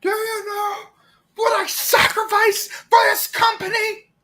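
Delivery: high outraged villain shout. Retold or imitated. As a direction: yell in wounded pride. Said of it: "Time Fire is Norman Osborn".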